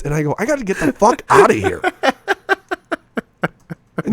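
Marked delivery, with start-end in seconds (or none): 0.4-1.8 exasperated. Retold or imitated. Retold exasperated exit line.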